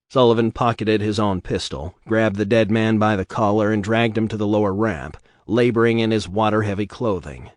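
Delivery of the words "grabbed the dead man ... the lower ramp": ordinary delivery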